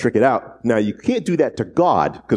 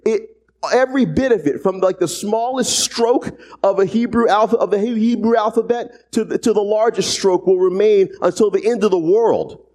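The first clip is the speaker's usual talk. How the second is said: higher, driving sermon voice; fired-up preaching; 10 semitones higher than usual. Acted. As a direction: preach it up high with conviction. Preaches at full force.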